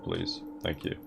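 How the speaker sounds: ordinary talk, nothing marked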